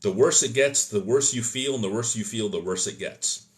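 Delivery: usual speaking voice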